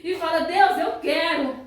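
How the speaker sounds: loud, fervent prophecy broken by chanted tongues; profecia fervorosa. Real, not acted. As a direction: preach at full force as the voice of God. (profecia em voz alta, alternando línguas e mensagem)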